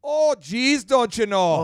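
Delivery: drawn-out Minnesotan, starting high; Minnesotan accent. Acted. Frustrated Minnesotan exclaiming, high mock accent. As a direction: lift the "oh, geez" in mock Minnesotan. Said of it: "But in Minnesota".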